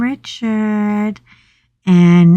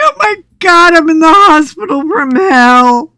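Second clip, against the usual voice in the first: high, loud mock-horrified wail; mock panic; 8 semitones higher, 5 dB louder than usual. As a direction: wail it in comic horror. Acts out her panic, amused.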